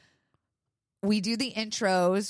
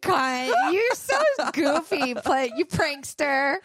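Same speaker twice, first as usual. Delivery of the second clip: high, loud shrieking mock delight; hammed-up shriek of joy at a fake prank; mock gushing shriek; 9 semitones higher, 4 dB louder, slower than usual. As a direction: squeal it like you're thrilled to be pranked. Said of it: "I'd be like".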